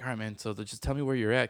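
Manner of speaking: usual speaking voice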